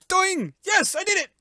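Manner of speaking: high cheer of victory; high triumphant yes; 1 triumphant shout. Real, not acted. Triumph after success.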